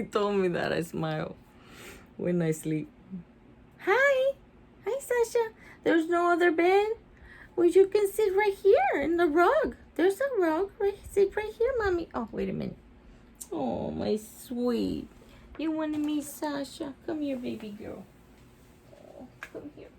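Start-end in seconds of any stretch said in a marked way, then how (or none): 3.8-12.1 high, sweet pet talk. Real, not acted. Owner greeting her Chihuahua, high cooing baby talk. 13.5-18.0 soft, tender call to the pet; tender soothing. Real, not acted. Owner coaxing her shy dog, soft and tender. 18.9-19.9 soft whisper to a pet; whispered coaxing. Real, not acted. Owner whispering to her scared dog, gentle coax.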